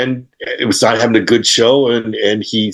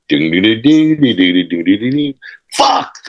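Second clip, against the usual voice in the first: imitated guitar notes. Imitating muffled guitar then curse.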